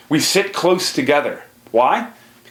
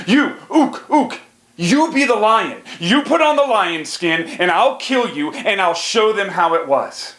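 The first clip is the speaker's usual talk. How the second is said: high-pitched chief ordering Ook about; bossy tribe leader; 8 semitones higher than usual. Acted. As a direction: boss Ook around like a caveman chief. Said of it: "And then he says".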